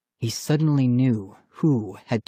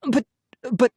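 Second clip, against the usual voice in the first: halting, broken-off protest; sputtering; 12 semitones higher than usual. A narrator's reading. Brother cut short defending himself, sputtering. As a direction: stammer out a stuck objection. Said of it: "Ian sputtered".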